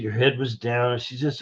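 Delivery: usual speaking voice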